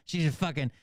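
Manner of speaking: ordinary delivery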